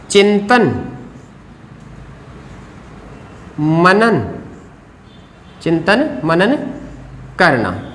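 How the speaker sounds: one word, then a long silence; writing-pace dictation. Real, not acted. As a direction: spell out the phrase word by word. Writes the phrase while saying it.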